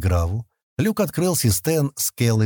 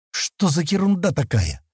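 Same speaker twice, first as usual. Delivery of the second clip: slow, higher-pitched puzzlement; bemused question to oneself; muttering, puzzled; 7 semitones higher than usual. A narrator's reading. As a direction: wonder aloud, drawing the words out. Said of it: "пробормотал он и покачал головой".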